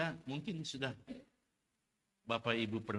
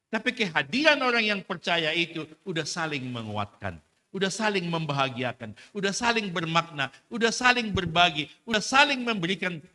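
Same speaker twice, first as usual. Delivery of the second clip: higher and much louder, each "udah saling" hammered; preaching climax; 8 semitones higher, 12 dB louder than usual. Real, not acted. Preacher urging the congregation to strengthen each other, full force. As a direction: drive the list home at full force.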